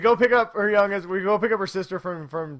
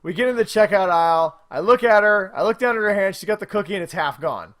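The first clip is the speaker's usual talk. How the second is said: ordinary talk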